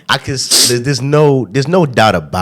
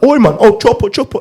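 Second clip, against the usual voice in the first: high, loud hammed-up London slang; overdone London accent; 13 semitones higher, 4 dB louder than usual. Friends faking London slang, badly hammed-up. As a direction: overact a bad Londoner. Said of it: "y'all put on a character".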